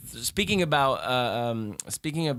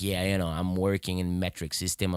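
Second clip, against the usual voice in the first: low-pitched mock dull party chatter; boring small talk; 7 semitones lower, 3 dB quieter than usual. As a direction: imitate a boring guest, voice dropped low. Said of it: "those boring conversations".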